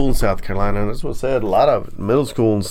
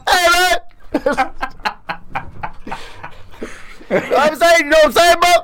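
put-on nineties East Coast accent.

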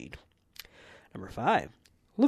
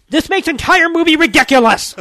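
much higher, laughing all-caps shout; shouting capitalized words; 12 semitones higher, 15 dB louder than usual. Retold or imitated. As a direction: yell it like an all-caps review. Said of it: "this part is written in all caps".

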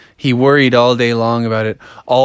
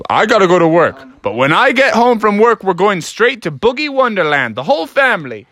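higher, lively mock-dad declaration; lightly acted emphatic father; insistent dad; 7 semitones higher than usual. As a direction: announce it like a dad who won't argue. Dad lays down the plan.

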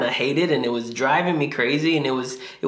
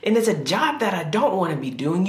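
ordinary delivery

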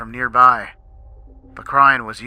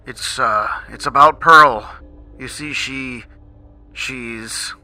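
stammering; 2 semitones higher, 4 dB louder, slower than usual. A narrator's reading. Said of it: "confused by Mister Krabs' stammering".

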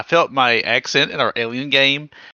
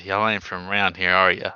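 husky, gravelly voice; 7 semitones lower, 3 dB quieter than usual. Shown as a demonstration. Coaching a game master on voices, husky and low. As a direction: drop your pitch for a husky cowboy. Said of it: "in a husky, gravelly voice".

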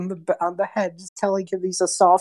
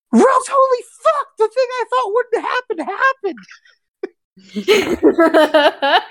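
very high, loud excited squeal; Caleb freaking out; 4 dB louder than usual. Acted. Caleb freaks out over the speaking stone, high excited squeal. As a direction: squeal it out in amazed disbelief. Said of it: "He just goes".

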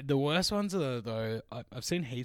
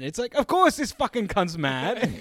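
ordinary talk, nothing marked

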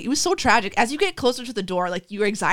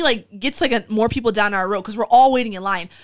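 ordinary talk, nothing marked

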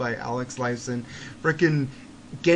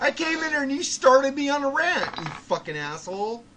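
angry rant; 9 semitones higher, 3 dB louder than usual. Real, not acted.

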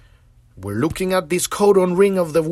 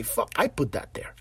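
softly, under the breath, owning the mess; a low, quiet confession to yourself; quiet admission; 6 semitones lower, 8 dB quieter than usual. Shown as a demonstration. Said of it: "owning it, said softly".

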